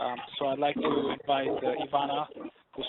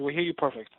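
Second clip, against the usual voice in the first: ordinary talk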